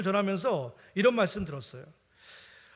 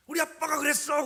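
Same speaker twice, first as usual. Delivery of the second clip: put-on high, loud proud kid's voice; confident child; 10 semitones higher, 5 dB louder than usual. Son before everyone, confident high child voice. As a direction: say it boldly like a sure little boy. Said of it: "너무 당당하게".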